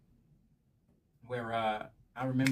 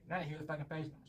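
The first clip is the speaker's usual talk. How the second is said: ordinary talk, nothing marked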